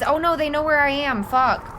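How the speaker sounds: ordinary delivery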